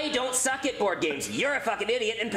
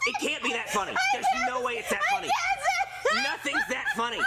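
exasperated disbelief. Acted.

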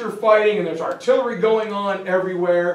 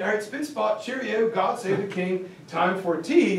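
softer, lightly voiced British accent, chirpy; mock British baker; 5 dB quieter than usual. Baker greeting the disguised crew, light mock British accent. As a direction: play the cheery English cook bursting in.

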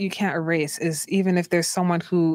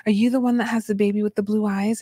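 lightly imitated gushing stranger, higher; higher-pitched retold excitement; mock excited nurse; 4 semitones higher than usual. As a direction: play a curious nurse coming up. Voicing nurses crowding round her.

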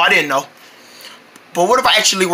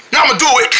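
ordinary delivery

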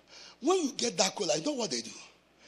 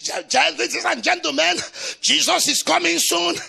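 louder announcing call to passengers; train preacher; 6 semitones higher, 11 dB louder than usual. Retold or imitated. Preaching in a Cape Town train, announcing loudly. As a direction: address the whole carriage.

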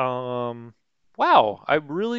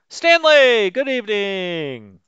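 high, loud call-out hello; loud greeting; 7 semitones higher, 7 dB louder, slower than usual. Real, not acted. Streamer hails a viewer joining the chat, loud greeting. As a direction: sing out the name in delighted welcome.

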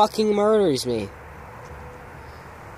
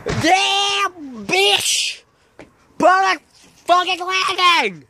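shrieking at a bad landing in the game; excited outburst; 10 semitones higher, slower than usual. Real, not acted. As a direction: yell it way up in pitch, caught off guard. Game landing goes wrong.

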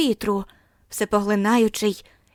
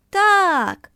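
much higher, loud eager yes; shouted together; 9 semitones higher, 6 dB louder than usual. A narrator's reading. Children answering the teacher, loud high shout. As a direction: shout it as one. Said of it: "вигукнули всі".

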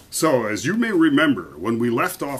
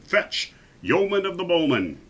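higher-pitched royal summons; commanding king; 7 semitones higher than usual. A narrator's reading. King calling for his best archer, commanding. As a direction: summon him grandly, as a king.

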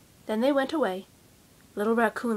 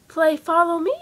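louder, higher chipmunk call; eager child; 5 semitones higher, 10 dB louder than usual. Acted. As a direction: call the raccoon to the game. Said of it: "said the sister".

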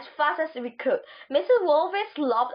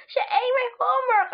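shouting; 11 semitones higher than usual. A narrator's reading. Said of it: "I shouted".

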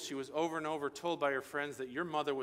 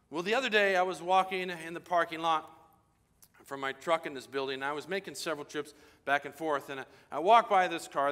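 normal talking voice

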